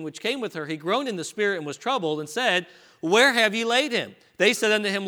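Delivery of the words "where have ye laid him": anguished question. Acted. Jesus asks at the tomb.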